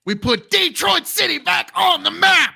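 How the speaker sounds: loud, high-pitched hammed-up proclamation; triumphant shout. Host announcing Michigan is reluctantly in, mock-triumphant shout. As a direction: shout it out like a rallying cry.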